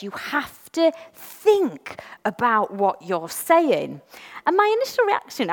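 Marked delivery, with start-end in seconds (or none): 0.0-4.0 slow, deliberate priest's rebuke; slow quote. Retold or imitated. Priest stopping her reading, slow and deliberate. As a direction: spell it out slowly. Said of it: "He said".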